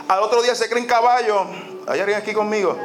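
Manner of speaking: ordinary delivery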